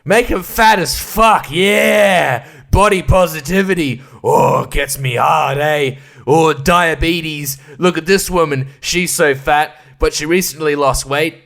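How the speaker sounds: over-the-top mocking cheer; mock loud jeering. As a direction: bellow it like a leering cheerleader. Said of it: "they're just like".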